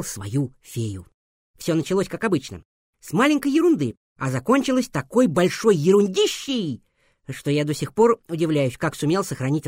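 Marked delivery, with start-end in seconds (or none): none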